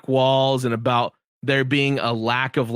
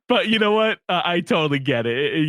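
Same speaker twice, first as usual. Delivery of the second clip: ordinary delivery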